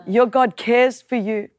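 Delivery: normal talking voice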